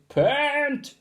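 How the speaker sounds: high, loud call from above; headmistress shouting. Acted. Headmistress shouting down to the teacher, high and loud. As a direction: shout down from the window.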